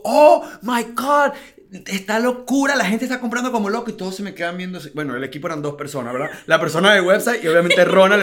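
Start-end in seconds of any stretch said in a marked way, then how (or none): 0.0-3.9 loud and high, stretched Oh my God; overjoyed. Retold or imitated. Running to his two-person team, high excited shout. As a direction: burst in on the team, thrilled.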